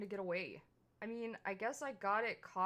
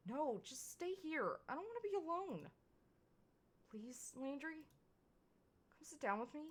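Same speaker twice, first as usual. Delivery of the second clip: pleading; 7 semitones higher than usual. Acted. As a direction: coax him back with a frightened little voice. Begging Landry to stay.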